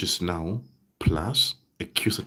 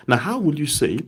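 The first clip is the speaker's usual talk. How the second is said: ordinary delivery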